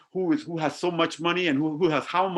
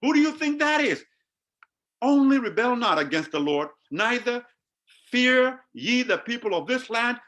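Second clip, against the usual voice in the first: urgent plea; 7 semitones higher than usual. Retold or imitated. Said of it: "I can imagine them saying".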